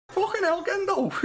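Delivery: high mock-Scouse, laughing; giggly imitation of a high Scouse voice; Scouse accent. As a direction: echo the squeaky Scouse impression. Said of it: "speaking of Scouse accents".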